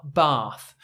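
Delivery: bath with a long open ah. Shown as a demonstration. Teacher models the long ah bath. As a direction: say bath as bahth. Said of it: "A with the colon on the left".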